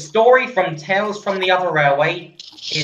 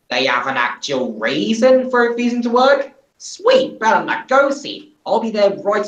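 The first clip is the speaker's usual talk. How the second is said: character voice; 7 semitones higher than usual. Acted. Thingit on the phone.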